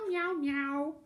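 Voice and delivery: high mewing meow, meow; cat meows. Shown as a demonstration. Librarian imitating a cat for kids, high mewing. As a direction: mew like a kitten, high. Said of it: "a cat would definitely say meow".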